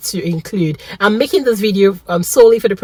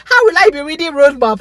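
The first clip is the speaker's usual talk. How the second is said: laughing disbelief; 10 semitones higher, 4 dB louder than usual. Real, not acted. Incredulous about road map.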